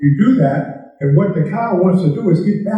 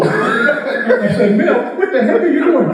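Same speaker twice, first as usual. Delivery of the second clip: laughing through the punchline, higher; can barely get it out for laughing; telling the punchline while cracking up; 6 semitones higher than usual. Real, not acted.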